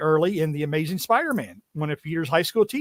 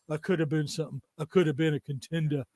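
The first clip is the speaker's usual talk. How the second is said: Brando impression; 2 semitones lower, 7 dB quieter, faster than usual. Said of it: "On the Waterfront is".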